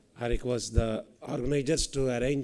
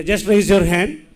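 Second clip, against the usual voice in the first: calling out; 6 semitones higher, 11 dB louder than usual. Real, not acted. Host calls out to audience.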